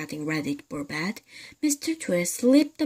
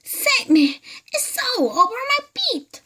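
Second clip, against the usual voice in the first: much higher, loud panicked hag; terrified shriek; 5 dB louder than usual. Acted. Mrs Twit begging her husband to save her. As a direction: scream in full panic.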